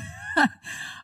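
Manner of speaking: squealing little giggle; real giggle at the funny part; high real giggle. Real, not acted. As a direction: let a high giggle escape. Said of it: "I have a little giggle".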